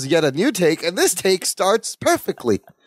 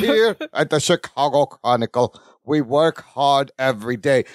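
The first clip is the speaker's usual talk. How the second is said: labored, over-modulated announcer-like acting; deep, forced delivery; 3 semitones lower than usual. [name] performs for the camera, deep and labored. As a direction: push each word out in a low, forced voice. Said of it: "in this weird, deep, forced, labored line delivery".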